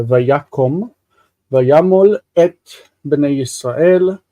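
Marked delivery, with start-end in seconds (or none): 1.5-2.6 vayamol said louder, leaning on mol; stress on mol. Shown as a demonstration. Teacher pointing out the last syllable, mol stressed. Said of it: "'cause it's mol".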